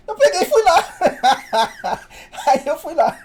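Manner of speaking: talking through laughter; can't stop laughing as he tells it; laughing while he retells it. Real, not acted. Laughing while telling the story.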